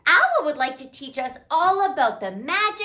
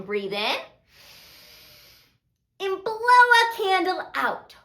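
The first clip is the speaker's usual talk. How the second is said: ordinary delivery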